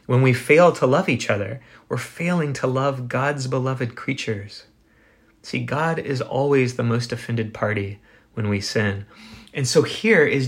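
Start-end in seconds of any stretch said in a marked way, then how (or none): none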